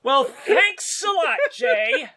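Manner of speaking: high, half-laughing whine; mock exasperated complaint. [name] grumbles to Jay after being cut off, mock exasperated. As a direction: protest in a hammed-up high voice through laughter. Mock complaint when co-host derails his return.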